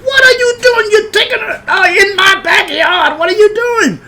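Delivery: high, loud imitated hysterical shrieking; banshee scream. Hostess finding boy in her backyard, banshee screaming. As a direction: shriek like a banshee who has lost it. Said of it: "She screamed like a banshee".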